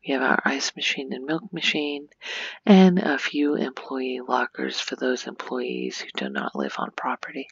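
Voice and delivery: usual speaking voice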